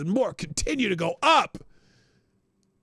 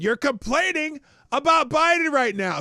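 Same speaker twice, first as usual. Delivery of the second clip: higher and louder, driving it home; raised exasperated restatement; emphatic repeat; 8 semitones higher, 5 dB louder than usual. Real, not acted. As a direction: hammer the point the second time. Repeats point, then louder.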